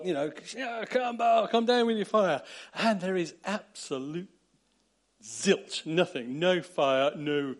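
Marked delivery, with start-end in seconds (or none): 0.5-2.4 higher, louder mock chant to Baal; hammed-up cry to a god; frenzied calling. False prophets calling on Baal, higher and louder. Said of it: "shouting, prophesying and calling on Baal".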